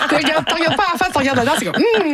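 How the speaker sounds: high giggling, teasing tone; playful laughing admiration. Real, not acted. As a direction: laugh while you tease about the dancers. Laughing while describing male dancers.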